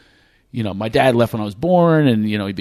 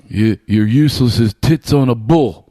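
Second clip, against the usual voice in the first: louder put-on dad voice; imitated father; 5 dB louder than usual. Father insulting his child, loud put-on impression. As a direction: imitate dad delivering a pet insult loudly. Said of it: "I'll talk like him".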